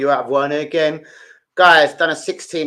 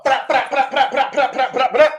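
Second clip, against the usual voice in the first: playful chant; 10 semitones higher than usual. Acted.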